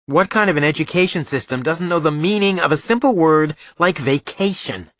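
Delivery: put-on high-pitched, sarcastic young son; indignant boy. Son griping about school testing during vacation, indignant. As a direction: complain indignantly, drawing out the last words.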